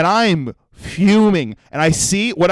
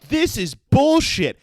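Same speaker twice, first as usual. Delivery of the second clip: high, drawn-out mock outrage; retold angry outburst; 10 semitones higher, much slower than usual. Fed-up customer to the whole waiting line, acted angry outburst. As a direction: act out an indignant public outburst, stretched out. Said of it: "just out loud, I go".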